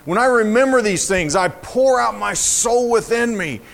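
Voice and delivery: high, impassioned lament, key words stretched; dramatic psalm reading. Acted. Preacher reading a downcast soul questioning God, impassioned lament. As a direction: plead the questions out with full weight.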